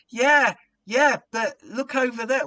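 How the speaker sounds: high, loud call across the tent. Retold or imitated. Compere diverting the crowd toward the policeman, calling out. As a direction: point them somewhere else. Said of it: "I said from the stage".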